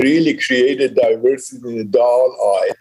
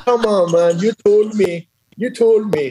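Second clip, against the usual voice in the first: higher-pitched, insistent mock pleading; retold protest; 7 semitones higher than usual. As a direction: replay pleading with a buyer, pitch raised. Retold protest to reluctant buyer.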